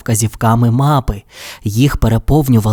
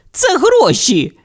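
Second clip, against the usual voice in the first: excited; slower than usual. Acted. Cat finds money.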